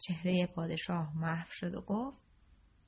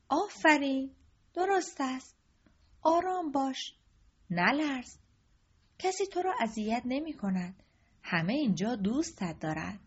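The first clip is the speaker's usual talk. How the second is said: lightly voiced king, gently calming a scared boy; king reassuring the trembling prince; soothing king; 5 semitones higher, 3 dB louder than usual. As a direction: coax him out of his fright.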